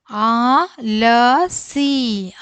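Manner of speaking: ആലസി stretched out syllable by syllable; very slow reading. Shown as a demonstration. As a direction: model ആലസി very slowly. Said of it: "അക്ഷരങ്ങൾ ഓരോന്നോരോന്നായിട്ട് വായിക്കാം".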